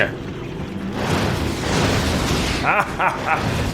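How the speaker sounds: breathy maniacal cackle; evil cackle. Pretend. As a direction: cackle over the battlefield. Villain laugh.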